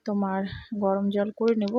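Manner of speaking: usual speaking voice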